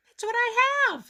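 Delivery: put-on high, sweet little girl voice; Betty Lou. A narrator's reading. Betty Lou offering Grover her extra sandwich, high and sweet. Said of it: "said Betty Lou".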